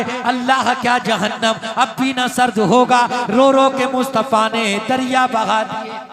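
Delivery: loud, driving preacher's litany; impassioned preaching. Acted. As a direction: build the list with rising fervour. Fervent sermon list of weeping.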